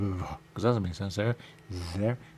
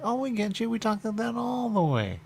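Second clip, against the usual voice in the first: mock quote; 12 semitones higher, faster than usual. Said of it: "They'd be like".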